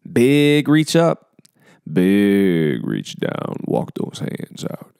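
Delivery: stretched, slow coaching cue; slow drawn-out cues. Acted. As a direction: draw each word out long.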